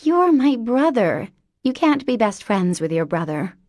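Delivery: put-on high, bright-eyed childlike voice; an eager little girl in a grown woman; childlike Mary Elizabeth.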